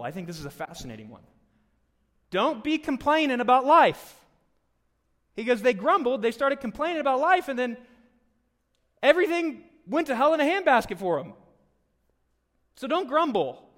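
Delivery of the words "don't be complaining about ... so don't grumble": loud, high, urgent preaching; fiery sermon climax; preaching at full force. Real, not acted. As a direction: drive the warning into the room.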